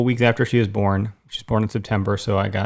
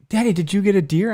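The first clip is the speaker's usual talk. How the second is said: higher-pitched child's question, imitated; imitated little daughter; 9 semitones higher than usual. Daughter asks daddy about his hunt, imitated little kid.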